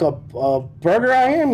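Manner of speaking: slow, high, stammered disbelief; retold incredulity. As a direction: stammer it high, re-enacting the shock. Retold shock at nearby rival store.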